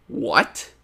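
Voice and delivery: high-pitched retold surprise, rising right up; squeaky, amazed what are you serious; stunned disbelief. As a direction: replay the shock of the call. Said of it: "I was so surprised".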